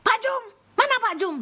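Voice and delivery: mock impatient calling; imitated shouting. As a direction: yell for a worker bossily. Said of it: "Saya nggak pernah teriak-teriak".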